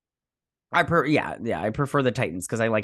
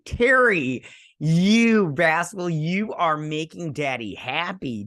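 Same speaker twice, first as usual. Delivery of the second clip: high, drawn-out playful gushing; gleeful mock scolding; 5 semitones higher, 4 dB louder, much slower than usual. Fan addressing the showrunner who pleased him, gleeful gushing. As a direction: stretch the words out with hammed-up delight.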